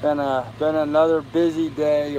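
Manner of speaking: slow, worn-out voice; tired. Real, not acted. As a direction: drag it out, exhausted. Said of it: "I am tired tonight".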